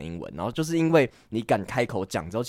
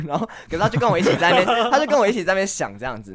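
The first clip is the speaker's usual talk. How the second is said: giggling through the words, higher and louder; laughing; 8 semitones higher, 5 dB louder, faster than usual. Real, not acted. Telling friends about the guy while laughing. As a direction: laugh as you tell it, fast.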